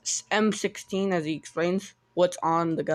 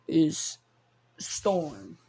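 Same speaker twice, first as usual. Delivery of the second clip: plain reading voice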